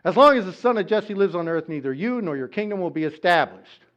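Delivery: threatening king. Acted. Saul warning Jonathan about David.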